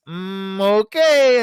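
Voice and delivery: high, loud put-on disappointed okay; a hammed-up resigned okay; mock sulky high okay; imitating the woman. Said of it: "and she just like".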